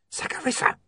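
unvoiced urgent hiss to get her attention. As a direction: whisper the name urgently. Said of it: "hissed William urgently".

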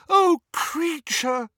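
high, slow, crying wail; tearful wail. A narrator's reading. Hermione moved by the elf's story, wailing. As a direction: wail it out with pity. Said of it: "wailed Hermione, who was crying".

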